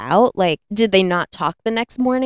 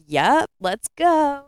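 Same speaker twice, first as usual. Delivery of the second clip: high, drawn-out eager reply; mock eager agreement; 7 semitones higher, much slower than usual. Old ladies choosing aliens over Dallas, mock eagerness. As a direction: draw it out high, playfully game.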